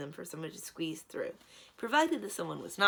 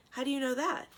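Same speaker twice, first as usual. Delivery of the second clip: curious; 8 semitones higher, 4 dB louder than usual. Acted. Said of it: "Alienora asked curiously".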